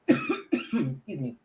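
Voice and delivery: slow, rough clear of the throat before apologizing. Real, not acted. Guest clearing her throat mid-answer, rough and slow. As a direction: clear the throat and excuse yourself. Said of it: "Excuse me, s-, my throat".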